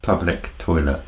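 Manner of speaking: "Public toilet" in a plain British English accent; measured British-accented "Public toilet"; proper British English. Shown as a demonstration. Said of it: "Could you say it again".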